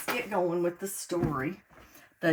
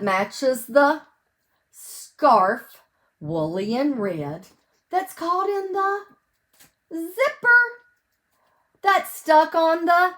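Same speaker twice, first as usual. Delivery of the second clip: loud, sing-song picture-book reading; reading to children; 4 semitones higher, 10 dB louder, slower than usual. Acted. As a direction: read each page big and bright for little listeners. Reads picture book aloud.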